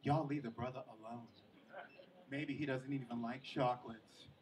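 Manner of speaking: low, soft, prim defending voice; sister-in-law defending Andre at dinner. Retold or imitated. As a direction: gently shush the family.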